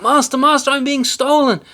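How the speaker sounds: very high, loud shriek for help; harp yelling. A narrator's reading. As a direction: scream to wake the master. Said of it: "the harp calling out".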